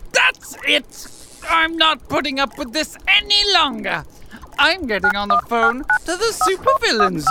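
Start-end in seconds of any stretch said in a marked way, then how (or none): 0.1-4.0 fed up and raised, higher; snap, you've had enough; exasperated. Acted. Villain loses patience, calls union.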